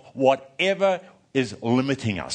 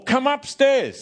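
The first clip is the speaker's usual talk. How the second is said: calling out; 9 semitones higher, slower than usual. Retold or imitated. Being called from upstairs.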